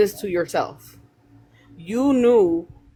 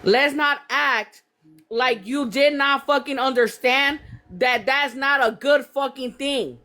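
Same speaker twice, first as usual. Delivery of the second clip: ordinary talk, nothing marked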